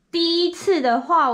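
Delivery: ordinary talk, nothing marked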